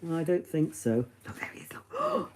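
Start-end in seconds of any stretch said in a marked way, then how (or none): 1.3-2.3 breathy conspiratorial whisper; excited whisper. Real, not acted. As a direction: whisper to the dog, pointing him out. Points out Mark to dog.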